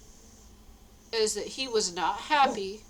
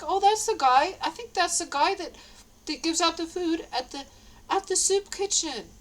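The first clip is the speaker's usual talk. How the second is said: excited recognition; 7 semitones higher, 4 dB louder than usual. Retold or imitated. Recognizing the choir singer.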